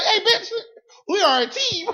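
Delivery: high, loud comic protest; mock indignation. Indignant retort to his own body.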